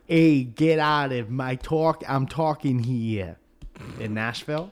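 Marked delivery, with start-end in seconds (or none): 0.1-3.4 loud, slow, pitched-up street yell; mock New York tough guy. Nashville locals joked as New Yorkers, in a mock tough-guy yell. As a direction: bark it like a cabbie. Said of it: "in Nashville where people are like".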